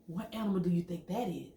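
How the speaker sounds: ordinary delivery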